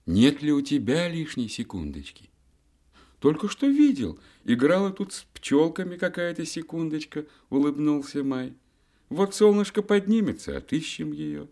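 high, gentle month voice; lightly voiced cheerful May. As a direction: play May as a sunny youth. Characters voiced in fairy tale.

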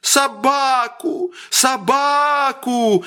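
высокий протяжный детский визг; тяни слово, капризничая; капризный визг. A narrator's reading. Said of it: "залился громче прежнего мальчик". (мальчик заливается криком)

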